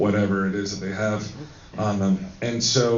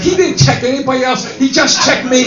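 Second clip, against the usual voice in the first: very high, loud mock complaint; Ming voice; 13 semitones higher, 7 dB louder than usual. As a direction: imitate an aggrieved friend, pitched way up. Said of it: "do it in the Ming voice".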